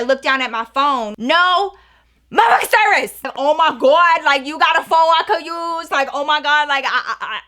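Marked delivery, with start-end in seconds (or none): 1.2-3.2 loud, high shocked cry at no service; panicking as the phone shows no bars; shocked outburst. Retold or imitated. Shopper sees no service and cries out in shock. 3.4-7.4 panicked. Retold or imitated. Panicking in checkout line.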